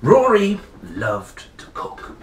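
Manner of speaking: slow, bright read-aloud opening; storytelling voice. A narrator's reading. Reader opening the picture book, lively storytelling. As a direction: draw the children into the story.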